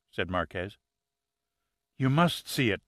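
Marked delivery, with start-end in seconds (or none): 2.0-2.8 excited. Acted. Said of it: "she said excitedly".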